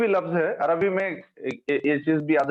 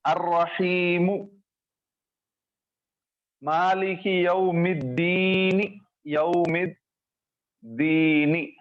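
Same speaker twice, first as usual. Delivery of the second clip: slow recitation; slower than usual. Shown as a demonstration. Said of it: "इसी तरीके से".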